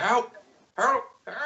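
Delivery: hammed-up distant cries for help; short, lightly acted scream for help; mock screams for help. Game master voices a victim heard from afar, mock screaming. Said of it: "you could hear screams of help".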